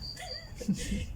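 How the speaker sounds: a real high airy giggle, unvoiced; high breathy laugh. Real, not acted. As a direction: let a short breathy laugh escape at the joke. Laughing at own anecdote.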